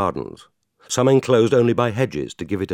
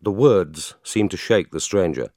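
usual speaking voice

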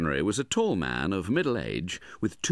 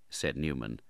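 plain reading voice

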